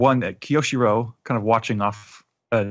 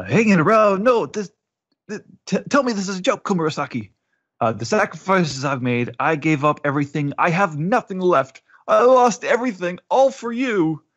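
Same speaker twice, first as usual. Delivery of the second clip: put-on desperate crying old man; 9 semitones higher than usual. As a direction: beg her in broken disbelief. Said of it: "he's crying now".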